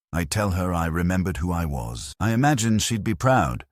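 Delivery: usual speaking voice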